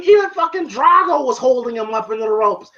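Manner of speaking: ordinary talk, nothing marked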